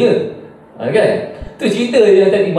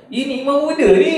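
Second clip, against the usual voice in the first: mock mother's exclamation; 12 semitones higher than usual. Mother reveals the guest.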